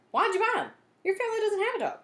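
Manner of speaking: high, loud disbelief; can't believe he bought dog whistles; surprised question. Acted. Cam questions Eric's odd purchase.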